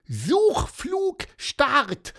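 very high, loud, stretched-out launch call; self-important chirp. A narrator's reading. Pieper launches his search flight, squeaky and self-important. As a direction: announce the launch like a tiny official. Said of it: "piepste er wichtig".

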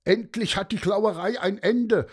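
high, indignant crowd cry; calling out. A narrator's reading. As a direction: shout in relief and outrage. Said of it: "riefen einige".

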